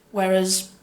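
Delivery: usual speaking voice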